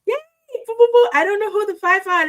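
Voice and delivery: high, loud excited cheering; excited squeal. Real, not acted. Streamer cheering gifted members in chat, excited squeals. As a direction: squeal with delight at new members. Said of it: "This is exciting".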